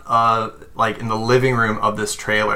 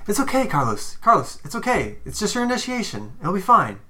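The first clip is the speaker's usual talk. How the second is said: much higher, sweet soothing coaxing; fake reassurance; 10 semitones higher, faster than usual. Jacob soothes crying Carlos before the ritual, sugary high coaxing. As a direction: soothe him like a nervous pet. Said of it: "Jacob's just like".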